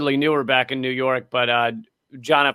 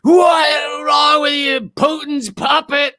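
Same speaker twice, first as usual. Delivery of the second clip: much higher, slow crying shout; mock furious shout; 11 semitones higher, 3 dB louder, slower than usual. Ex-friend tearing into John at a comedy club, hammed-up tearful shout. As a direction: tear into him, yelling.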